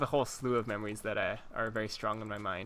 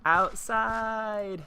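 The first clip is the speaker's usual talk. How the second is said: high, drawn-out excited yell; retold scream; 10 semitones higher, 4 dB louder than usual. Kid yelling on the way out to the window, mock scream. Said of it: "I screamed".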